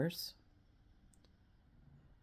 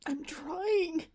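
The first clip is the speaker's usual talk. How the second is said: high, breaking voice with sobs between words; crying out each line, choking on the words; sobbing; 11 semitones higher than usual. Real, not acted. Vlogger describing her anxiety to viewers, sobbing. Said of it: "I didn't mean to get emotional".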